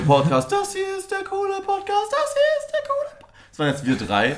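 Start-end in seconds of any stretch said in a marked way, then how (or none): 0.5-2.1 very high falsetto, slow. Pretend. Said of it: "in drei unterschiedlichen Höhen". 2.1-3.1 squeaky falsetto, even higher. Pretend. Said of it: "in drei unterschiedlichen Höhen".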